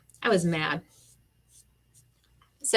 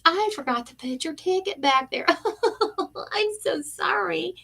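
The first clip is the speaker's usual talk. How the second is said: high, sing-song mock of the receptionist; mocking fake apology; 11 semitones higher than usual. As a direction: mimic her sugary sorry, hammed up.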